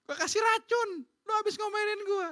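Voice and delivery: high-pitched mock-indignant wife; acted indignation, pitched well up; wife's comeback. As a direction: retort high as a scolded wife. Replaying the wife's angry comeback.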